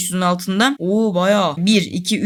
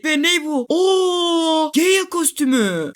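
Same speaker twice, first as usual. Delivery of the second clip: high, delighted gasp at the prize; heyecanlı şaşkınlık; 6 semitones higher, much slower than usual. Real, not acted. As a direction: yelp with joy at the new skin. Yeni kostüm kazanıyor. (ödül çıkınca tiz, sevinçli şaşkınlık)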